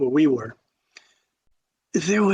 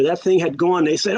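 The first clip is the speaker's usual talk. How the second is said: plain reading voice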